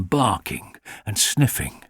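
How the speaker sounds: usual speaking voice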